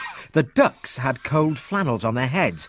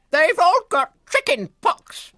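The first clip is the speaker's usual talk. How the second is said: very high, bossy clucking hen voice; clucking nurse chicken; 3 dB louder than usual. Acted. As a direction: bustle in like a fussy hen nurse. Florence explains.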